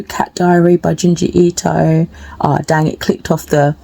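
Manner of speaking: ordinary delivery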